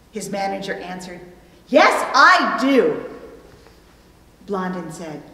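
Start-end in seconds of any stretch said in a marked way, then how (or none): 1.7-3.1 loud, slow, certain answer; a confident manager's loud reply; emphatic yes. Acted. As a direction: declare it boldly and slowly.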